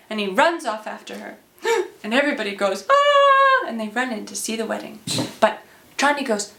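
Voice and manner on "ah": high, loud mock-shocked "ah"; acted collective cry of surprise; crowd gasp. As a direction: gasp aloud like a startled crowd. Whole crowd gasps as groom runs off.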